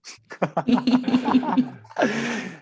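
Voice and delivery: high, loud laugh of pleasure; real delighted laugh. Real, not acted. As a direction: laugh happily at the compliment. Laughs after "you've made my day.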